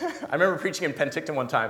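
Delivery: normal talking voice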